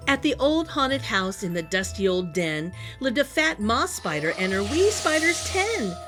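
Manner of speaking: ordinary delivery, nothing marked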